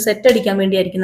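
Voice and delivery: plain reading voice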